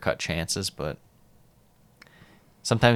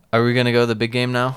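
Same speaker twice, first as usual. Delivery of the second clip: fast, louder hype-up; excited hype; 4 semitones higher, 6 dB louder, faster than usual. Real, not acted. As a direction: build it up, eager. Hyping next match.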